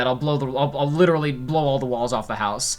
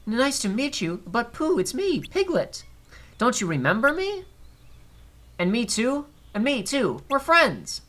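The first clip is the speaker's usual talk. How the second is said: high, timid little pig voice; Piglet voice; 10 semitones higher, slower than usual. Acted. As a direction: pipe up like a worried tiny piglet. Voicing Piglet in cutscene.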